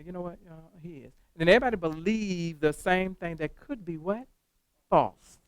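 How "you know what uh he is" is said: quiet confiding murmur, much softer; gossipy hush, much softer; hushed gossip. Retold or imitated. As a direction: pass it on like a secret. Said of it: "Kim go up there to Tori Ann and say".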